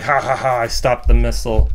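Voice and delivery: loud mock villain laugh and boast; lightly acted triumphant gloat. Robot boasts it caught the missile, gloating villain.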